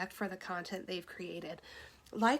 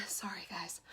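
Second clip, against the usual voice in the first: whispered apology; 3 semitones lower, 12 dB quieter than usual. Real, not acted. Apologizing after a coughing fit.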